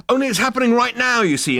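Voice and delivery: fast, high, loud urgency; feverish insisting. A narrator's reading. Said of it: "insisted Zaphod feverishly".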